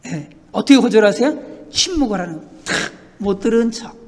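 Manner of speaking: normal talking voice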